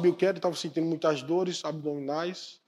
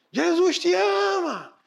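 drawn-out shout, much higher and louder; 11 semitones higher, 6 dB louder, much slower than usual. Acted. Man obeying the Spirit at 3am, shouting slowly. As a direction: yell it slowly out to the empty street. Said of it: "Grite de novo".

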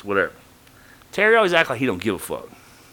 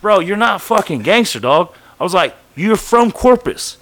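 loud, higher confrontation; calling out Terry in front of the line, loud; calling him out; 6 semitones higher, 6 dB louder than usual. Retold or imitated. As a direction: tear into him in front of everyone.

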